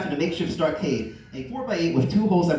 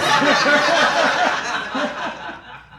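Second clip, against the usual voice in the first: high howling laugh; helpless laughing; loud laughter fit; 10 semitones higher, 7 dB louder than usual. Real, not acted. Reader cracks up.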